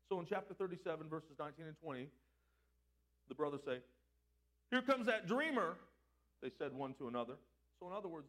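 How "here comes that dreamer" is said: loud, slow, high-pitched jeer; mocking. Brothers jeering as Joseph approaches, mocking. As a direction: draw it out loudly to make fun of him. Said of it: "they were mocking him".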